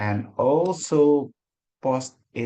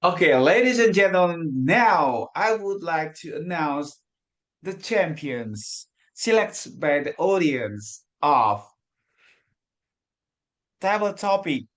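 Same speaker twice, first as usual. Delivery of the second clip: pageant host; 3 semitones higher, 4 dB louder than usual. Acted. Announces winners like a host.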